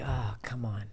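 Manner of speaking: soft, low sighing aside; muttered disbelief. Retold or imitated. Guest imitating a listener doubting a silly voice, hushed dismissal. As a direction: breathe it out as a retold private reaction.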